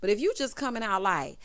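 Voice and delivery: ordinary talk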